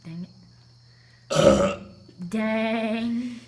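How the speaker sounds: words burped out; burping the words. Shown as a demonstration. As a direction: burp each word out. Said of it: "Take your time on this if you need".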